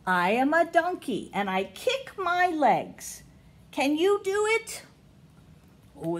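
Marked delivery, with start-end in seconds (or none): 0.0-4.8 storybook voice; reading to children. Acted. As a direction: read to the little ones, lifting each question. Reading picture book to kids.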